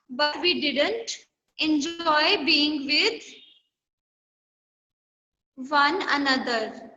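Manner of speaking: slow, spaced-out reading of the sentence; slow dictation reading. Shown as a demonstration. Teacher reading the exercise slowly to the class. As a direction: read it word by word so students can follow.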